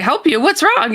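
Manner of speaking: playing back a startled reply; startled questioning. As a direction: react startled to a plea for help. Startled reply to panicked friend's call.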